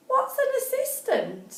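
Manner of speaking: put-on high little girl asking; squeaky innocent question; childlike voice.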